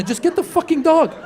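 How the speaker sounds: mock-exasperated, pitch jumping up; mildly hammed-up fed-up outburst; exasperated husband. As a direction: give in with comic exasperation. Acted exasperated husband giving in.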